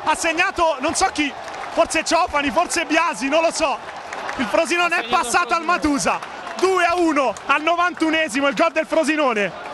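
high, breathless elated commentary; breathless italian goal commentary. Real, not acted. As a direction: gasp it out, still elated. Frantic last minutes after goal.

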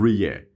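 ریه said "ree-e", gliding straight past the H; silent H. Shown as a demonstration. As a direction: show the syllables ریه with no H sound. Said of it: "یعنی اچ تلفظ نمیشه". (تلفظ «ریه» بدون صدای اچ)

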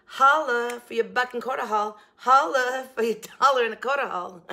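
loud, high jingle with a laugh; announcer jingle voice. Pretend. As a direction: sell the haul name like a jingle. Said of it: "jingle for my shopping hauls".

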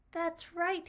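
high, loud and pleased; happy realization. A narrator's reading. Sprout suddenly sure of himself, bright happy realization. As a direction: say it through a big smile. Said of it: "smiled a big smile".

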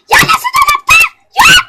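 shrieking disbelief. Acted. Daughter shrieking at mum that the bag isn't there, very high. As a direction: squeal it in shocked outrage.

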